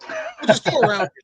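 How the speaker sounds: very high mock-exasperated voice; exasperated retort. Cop brushing off blocked driver, comic exasperation. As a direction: imitate a retort with pitch shooting up. Cop's retort in retold quarrel.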